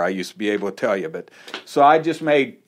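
usual speaking voice

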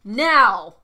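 loud, joyful shout of the answer; triumphant shout. Real, not acted. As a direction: blurt it out loud, proud and happy. Answering a planned setup question.

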